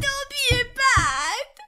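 shrill drawn-out second shriek. A narrator's reading. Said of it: "فریاد زد".

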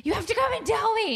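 very high, quick squeal of reproach; frantic mom re-enacted in a squeaky rush; high-pitched mock outburst; retold exasperated scolding. Retold exasperated plea to child.

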